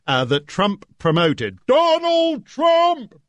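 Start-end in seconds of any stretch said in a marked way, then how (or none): 1.7-3.2 slow, high announcer shout; huge billing voice. Retold or imitated. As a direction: shout it out like giant lettering. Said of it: "in huge writing".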